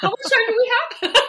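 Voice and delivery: laughing through a fast question. Real, not acted. Guest laughing at the host's big question, laughing. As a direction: burst out laughing as you ask.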